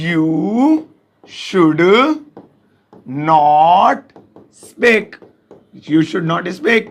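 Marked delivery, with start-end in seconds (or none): 0.1-5.0 slow word by word. Shown as a demonstration. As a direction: dictate slowly word by word. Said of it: "तो देखिए कैसे बोलेंगे". (एक-एक शब्द धीरे-धीरे)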